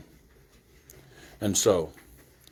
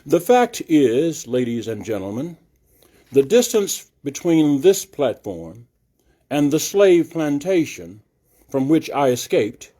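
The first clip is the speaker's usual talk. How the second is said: louder, stately nineteenth-century oration; oratorical reading; 2 semitones higher, 4 dB louder than usual. Shown as a demonstration. Reader voicing Frederick Douglass's address to the assembly, louder and stately. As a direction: proclaim it to the hall like Frederick Douglass. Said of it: "I'm trying to do it with the inflection of the speaker".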